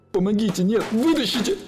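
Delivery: high, loud panicked screams; hysterical screaming. A narrator's reading. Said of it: "в истерике биться об стенку гроба и кричать".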